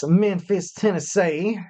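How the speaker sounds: put-on playful go at a Memphis accent. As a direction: put on your best Tennessee accent. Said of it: "that's my best, um, you know, accent".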